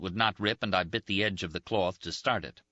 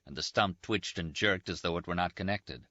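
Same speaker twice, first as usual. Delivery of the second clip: plain reading voice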